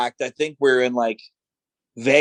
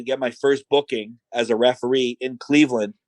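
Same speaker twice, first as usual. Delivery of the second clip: usual speaking voice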